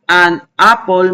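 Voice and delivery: "An apple" with open "ah" vowels, slowly. Shown as a demonstration. Teacher modelling "An apple" slowly with open A. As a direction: say "An apple" slowly as "ahn ah-pol". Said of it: "el sonido de la A abierta".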